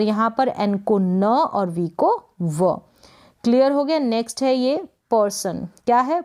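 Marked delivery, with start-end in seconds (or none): none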